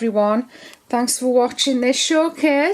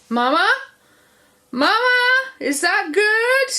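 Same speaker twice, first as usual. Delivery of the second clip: put-on high, squeaky American accent; a shaky, high American twang; 12 semitones higher, 4 dB louder than usual. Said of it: "That's my useless American accent".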